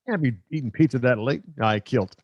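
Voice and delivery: usual speaking voice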